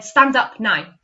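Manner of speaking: loud, firm order; bossy command. Shown as a demonstration. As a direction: order them to their feet. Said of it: "It's a command".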